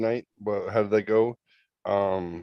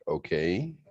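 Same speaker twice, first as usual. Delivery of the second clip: low, soft okay with a rise; attentive okay; 4 semitones lower, 6 dB quieter than usual. Shown as a demonstration. Friend actively listening to a texted story, attentive. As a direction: softly prompt them to continue. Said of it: "actively listening".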